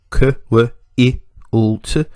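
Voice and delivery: k, w, i, u, t as separate short sounds; sounded out. Shown as a demonstration. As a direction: break quilt into phonemes with little gaps. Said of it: "segmenting and sym".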